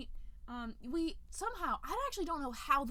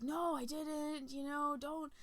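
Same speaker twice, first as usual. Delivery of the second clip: scared denial; 6 semitones higher, slower than usual. Retold or imitated. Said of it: "he's all scared".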